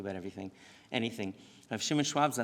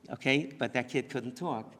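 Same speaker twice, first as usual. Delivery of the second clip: normal talking voice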